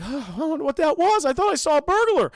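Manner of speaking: high, loud mock-nervous dog voice; hammed-up anxious squeal; freaked out. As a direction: imitate a jittery cowardly dog. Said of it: "Courage is still freaked out".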